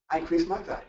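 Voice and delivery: ordinary delivery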